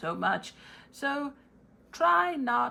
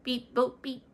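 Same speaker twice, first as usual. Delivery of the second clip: robot beeps; 4 semitones higher than usual. Acted. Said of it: "Mister Robot, what would you say?".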